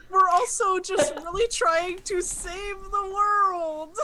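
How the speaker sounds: high, drawn-out plea; pleading. Acted. Player excusing the group to the tomb voice, pleading.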